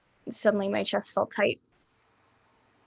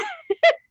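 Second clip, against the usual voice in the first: high, squealing laugh; 7 dB louder than usual. Real, not acted. Laughs as she retells.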